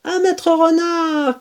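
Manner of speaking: high cheering toast; cheering together. Acted. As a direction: raise the glass and cheer. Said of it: "crièrent-ils tous en chœur".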